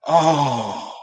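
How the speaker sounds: long, falling "oh" of disappointment; acted crushed groan; deflated groan. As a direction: let the air out after a painful miss. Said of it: "that was how I felt".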